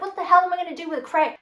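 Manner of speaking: high, fast exasperated outburst. Retold or imitated. Retelling her outraged reply to the shipping company, fast and high.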